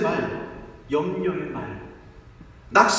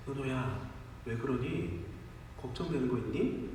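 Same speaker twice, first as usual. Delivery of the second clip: gentle father; 7 semitones lower, 11 dB quieter than usual. Retold or imitated. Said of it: "이렇게 말했습니다".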